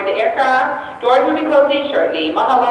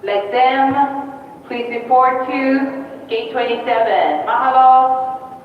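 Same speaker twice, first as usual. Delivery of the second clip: airport PA announcement; echoing over loudspeakers; over the intercom; slower than usual. Real, not acted.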